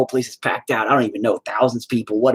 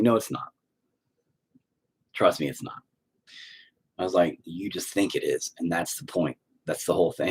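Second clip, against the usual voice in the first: soft reassurance; 4 semitones lower, 8 dB quieter than usual. Retold or imitated. Consoling the fan softly.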